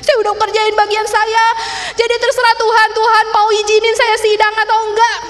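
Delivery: high, loud mock huff; retold petulant outburst; sulking at God. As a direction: throw it back at God in protest. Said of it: "saya ngambek sama Tuhan".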